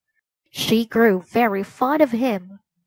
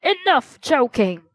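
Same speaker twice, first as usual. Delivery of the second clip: angry king; 5 semitones higher than usual. Acted. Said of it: "The king became angry".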